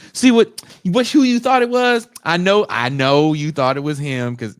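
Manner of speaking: high, loud teasing "I know, I know"; mock knowing tone. Mocking the lying tenant, high and loud. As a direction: needle the liar with a singsong.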